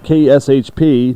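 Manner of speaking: usual speaking voice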